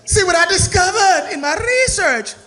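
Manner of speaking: high, excited stuffy scientist; posh scientist voice. Acted. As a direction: squeal over a big discovery. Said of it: "they in there like they say".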